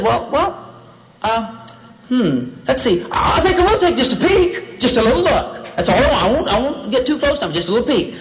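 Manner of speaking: high, hesitant then eager; lightly hammed-up coy curiosity; tempted Eve. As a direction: talk yourself into it, voice lifting. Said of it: "and she said".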